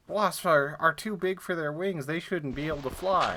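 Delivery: squeaky parody of a smug classmate; mock know-it-all. High school classmates insisting wasps can't fly, mocked loud and high. Mocking what classmates claimed.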